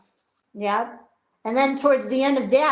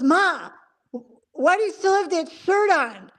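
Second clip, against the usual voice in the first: higher-pitched imitation of a complaining son; mock complaint; 7 semitones higher than usual. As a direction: play a son protesting to his mom. Acts son complaining to mom.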